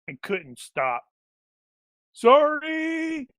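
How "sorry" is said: high, loud far-off yell of sorry; shouted apology. Acted. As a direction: yell it out as you skid past. Character yells apology while sliding.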